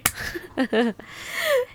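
laughing. Real, not acted. Friend laughs at her.

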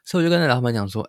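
usual speaking voice

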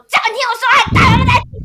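high, loud furious shout; shrieking demand to be heard; retold furious yell. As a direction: scream it at him across the table.

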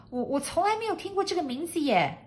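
high, fast disbelief; high, quick, puzzled maid; doubtful maid. A narrator's reading. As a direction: blurt your doubt in a high voice. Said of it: "怀疑地再说了一遍".

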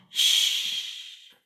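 unvoiced shush; a soft shh to keep everyone quiet; hushing sound. A narrator's reading. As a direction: hush them without voice. Hushing before the seagull.

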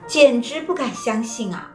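plain reading voice

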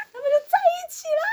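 squealing up high with glee; excited squeal. Real, not acted. Fan squealing to her co-host that they're finally together, high and gleeful. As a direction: gush like a delighted fan. Gushing about the couple getting together.

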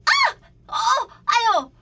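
loud, high yelps from a fall; demonstrated tumble cries; mock cries of pain. Shown as a demonstration. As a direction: separate sharp ows, acted out. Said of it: "一般正常人跌倒会这样".

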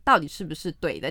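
ordinary talk

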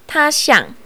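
ordinary delivery, nothing marked

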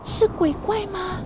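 high startled cry, then a scared question; a fisherman spooked by a figure on the water; frightened. Acted. Disciple sees a figure walking on the sea, frightened. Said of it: "他心里好害怕".

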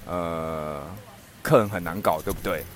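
轻声安慰老板; consoling. Retold or imitated. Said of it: "我想要安慰他一下". (低声体贴地安慰)

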